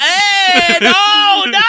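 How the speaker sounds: high, loud Italian-accented cartoon protest; squealing Italian-accented cartoon coward; Italian Shaggy. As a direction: wail it with a hammed-up Italian accent. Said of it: "do Italian shaggy".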